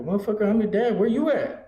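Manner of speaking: high, incredulous retort to his son; Dad snapping back at his son on FaceTime, mock indignant. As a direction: play the outraged father on FaceTime. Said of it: "I go".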